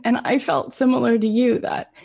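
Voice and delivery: ordinary delivery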